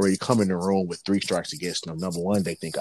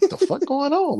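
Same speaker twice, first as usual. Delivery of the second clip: startled; 4 dB louder than usual. Retold or imitated. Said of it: "I'm like".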